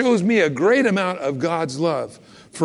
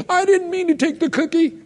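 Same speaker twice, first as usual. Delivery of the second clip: put-on higher, whiny little-kid voice; child caught confessing to a parent; mock child pleading; 11 semitones higher than usual. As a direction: play the guilty kid blurting out.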